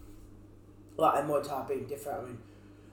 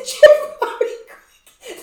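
names wheezed through laughter; laughing; 15 dB louder, much slower than usual. Real, not acted. Laughing listing [name].

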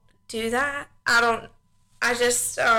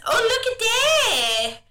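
much higher, slow, drawn-out wonder; excited child; 6 semitones higher, much slower than usual. Retold or imitated. Mother imitating her son showing her things. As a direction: gasp it like a toddler pointing.

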